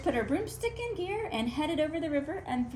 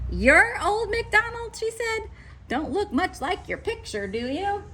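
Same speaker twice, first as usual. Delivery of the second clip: surprised witch; 9 semitones higher, 3 dB louder than usual. A narrator's reading. Grinch sizes up farmer.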